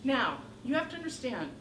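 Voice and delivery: ordinary delivery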